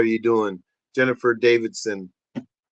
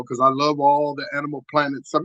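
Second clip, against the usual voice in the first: ordinary delivery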